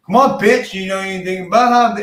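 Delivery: loud, high, snarling taunt at a rival; mock heel taunt. Heel Nia taunting Bayley during the chase, loud and high mock snarl. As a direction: bark it like a heel bullying her challenger. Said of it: "I would've liked to see the heel Nia chase".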